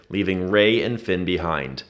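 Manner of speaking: usual speaking voice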